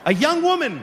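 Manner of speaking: high, surprised reveal. Real, not acted. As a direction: say it in disbelief, up high.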